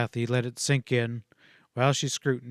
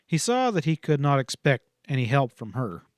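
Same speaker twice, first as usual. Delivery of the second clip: ordinary delivery, nothing marked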